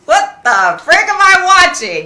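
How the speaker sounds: high, loud disbelief; incredulous outburst. Retold or imitated. Viewer reacting to a psychic livestream, incredulous. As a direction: burst out, appalled at what you see.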